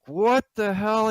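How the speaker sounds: high, drawn-out alarmed outburst; apoplectic. Acted. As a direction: burst in, frantic and high-pitched.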